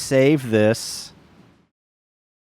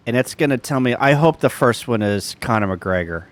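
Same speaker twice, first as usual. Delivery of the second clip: usual speaking voice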